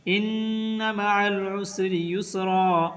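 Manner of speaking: slow, melodic tajweed chant; धीमी तिलावत की आवाज़; Quran recitation. Shown as a demonstration. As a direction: recite it slowly like tilawat. Recites Quran verse as example.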